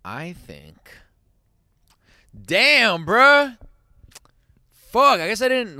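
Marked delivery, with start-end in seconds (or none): 2.4-5.8 high, loud dismayed cry; loud exclamation. Real, not acted. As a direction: blurt out dismay. Stung by the critique.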